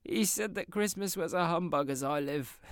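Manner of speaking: high, loud gleeful exclamation; laughing cry. Acted. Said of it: "cried Scrooge's nephew".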